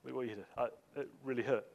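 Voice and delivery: low, very soft appeal; quiet hurt reproach. Acted. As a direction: act out a hushed, wounded confession. Models a gentle private confrontation.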